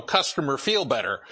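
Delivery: ordinary talk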